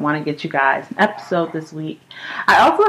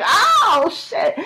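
mock scream. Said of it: "It's like".

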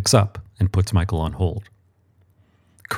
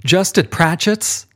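high, slow, disbelieving; a boss doubting his man; Archangel; 9 semitones higher, slower than usual. A narrator's reading. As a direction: drag it out high and unimpressed. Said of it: "the Archangel asks".

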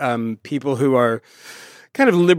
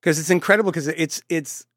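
normal talking voice

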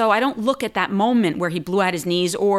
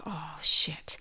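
whispered, sinking realization; hushed dread; 9 dB quieter than usual. Retold or imitated. Daughter taking injured dad's car keys, hushed dread.

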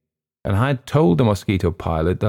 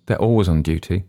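usual speaking voice

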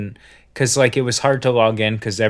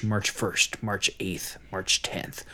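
each date softer until a whisper; fading whisper countdown; 3 semitones lower, 9 dB quieter than usual. Shown as a demonstration. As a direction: let the countdown sink to a whisper. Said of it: "counting down the days".

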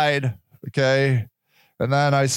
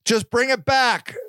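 calling out; 8 semitones higher than usual. Retold or imitated. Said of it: "I'm like".

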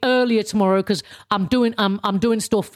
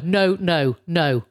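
ordinary talk

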